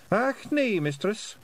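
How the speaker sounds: high squeaky Scottish pixie; tiny Scots warrior, chirpy and high; Feegle voice. Acted. Feegle refusing.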